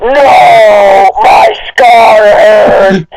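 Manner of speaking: very slow, crying mock wail of pain; hammed-up cry. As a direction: drag the scream out like bad theatre. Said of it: "Or alternatively".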